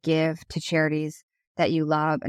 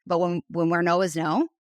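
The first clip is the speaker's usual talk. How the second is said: ordinary talk, nothing marked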